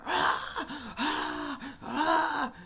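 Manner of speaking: high, loud mock cries of pain; imitating injured boy yelling. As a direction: cry out as if hurt. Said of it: "he's like".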